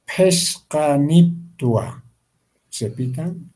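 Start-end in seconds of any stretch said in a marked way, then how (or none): 0.1-2.0 Peskaniptua stretched out very slowly, every syllable separated; teacher slowing Peskaniptua right down for the class; very slow articulation. Shown as a demonstration. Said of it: "enfatizar en las palabras que dificultan". (Peskaniptua muy lento, sílaba por sílaba)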